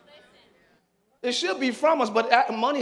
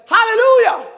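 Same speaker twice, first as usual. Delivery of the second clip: high, loud praising shout; full-throated hallelujah; 13 semitones higher, 14 dB louder than usual. Retold or imitated. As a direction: cry it out in praise. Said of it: "you're like".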